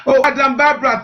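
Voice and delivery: ordinary talk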